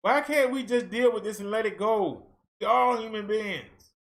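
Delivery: normal talking voice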